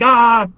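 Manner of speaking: short high yelp, retold lightly; mock high-pitched yelp as he charges off; little scream. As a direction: imitate a sudden little battle scream. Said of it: "he did, like, a little scream".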